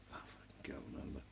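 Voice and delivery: a muttered whisper to himself; whispered aside. Real, not acted. Forgetting his travel plans, he whispers to himself. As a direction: drop to a breathy whisper, annoyed.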